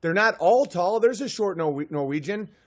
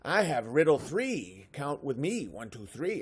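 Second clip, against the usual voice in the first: giggly leprechaun; 5 semitones lower, 4 dB quieter than usual. Acted. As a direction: play a sly leprechaun dropping into a murmur. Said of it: "They're like".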